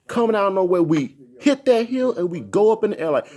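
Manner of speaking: usual speaking voice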